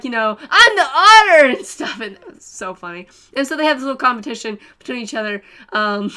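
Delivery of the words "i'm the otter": much higher and louder, laughing; imitating Momo proudly claiming the otter; playful boast. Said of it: "Momo was like".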